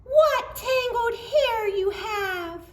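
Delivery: put-on high, slow, sweetly teasing girl; little girl voice. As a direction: coo it like a cheeky child.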